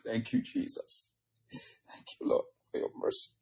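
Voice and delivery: whispered prayer of gratitude; hushed thanks. Real, not acted. Preacher thanks the Lord for mercy, whispered. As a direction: drop to a breath, thanking softly. Hushed praise after the whoop.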